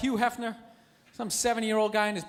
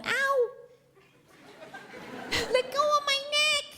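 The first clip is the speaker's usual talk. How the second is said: very high mock wail; lady in pain; 13 semitones higher, slower than usual. Lady on the train to her boyfriend, high mock-pained wail. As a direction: squeal it like an imitated woman being hurt. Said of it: "I hear this lady's voice".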